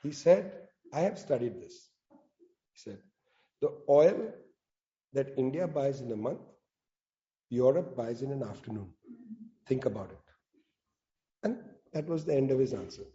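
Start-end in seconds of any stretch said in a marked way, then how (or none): 0.9-1.9 low, quiet, unhurried reply; super calm. Retold or imitated. Foreign minister answering reporters, calm and low. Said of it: "Jai, he was super calm". 3.6-10.2 low, quiet, unhurried reply; super calm. Retold or imitated. Foreign minister answering reporters, calm and low. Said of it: "Jai, he was super calm".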